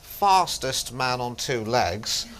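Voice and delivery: slow, measured record-announcer impression; Norris McWhirter. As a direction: announce it deliberately, word by word. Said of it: "That was Norris McWhirter".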